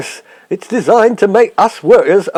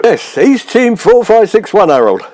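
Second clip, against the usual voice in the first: ordinary talk, nothing marked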